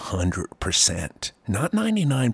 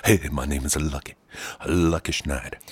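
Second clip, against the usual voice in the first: lower, quicker, laid-back guy voice; a lower cool-kid take on Lucky; 8 semitones lower, 6 dB quieter than usual. Pretend. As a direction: drop the pitch and breeze through it. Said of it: "He'd talk like this".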